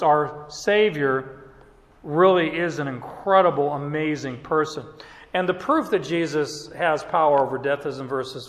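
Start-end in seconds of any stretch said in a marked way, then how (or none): none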